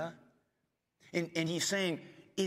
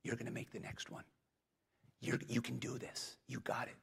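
much softer whispered reassurance; gentle whisper; 7 dB quieter than usual. Retold or imitated. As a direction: whisper courage to a discouraged kid. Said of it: "you just kind of whisper".

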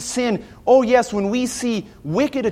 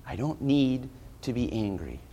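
much lower and softer, letting the anger go; hushed prayer; 7 semitones lower, 8 dB quieter, faster than usual. Retold or imitated. A believer handing his wrong to God, hushed and low. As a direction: hand it over to God under your breath.

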